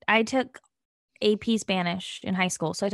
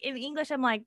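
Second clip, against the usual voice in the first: usual speaking voice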